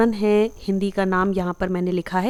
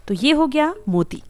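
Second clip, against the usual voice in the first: ordinary delivery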